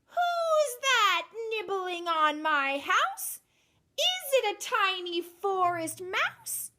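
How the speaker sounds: high, slow, drawn-out witch's crooning; creepy witch voice. A narrator's reading. Witch calling from inside her house, slow and high. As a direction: draw each word out like a hidden witch. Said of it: "they heard a voice saying".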